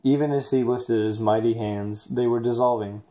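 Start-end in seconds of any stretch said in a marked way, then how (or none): none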